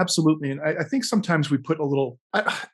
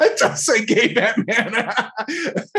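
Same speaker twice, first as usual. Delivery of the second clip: high-pitched, helpless laughter through the words; slow, laughter-broken speech; laughing; 6 semitones higher, much slower than usual. Real, not acted. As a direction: crack up as you read it out. Cracking up at on-screen text.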